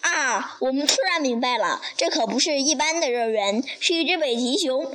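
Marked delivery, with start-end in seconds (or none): none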